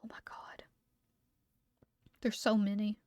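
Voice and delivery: usual speaking voice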